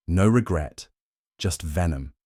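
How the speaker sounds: ordinary delivery, nothing marked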